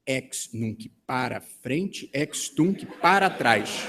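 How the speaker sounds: very slow, deliberate read-out; measured, drawn-out comic reveal; reading out punchline. Acted. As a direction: spell out the secret note, word by word. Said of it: "E o papelucho dizia assim".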